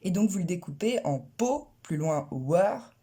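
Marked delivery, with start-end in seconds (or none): 1.2-2.9 po then slow heavy wer, wrongly cut; slow x0.5, po and wer pulled apart; wrong syllable split. Shown as a demonstration. Teacher shows the wrong way French speakers cut power. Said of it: "le découpage, du coup, est faux".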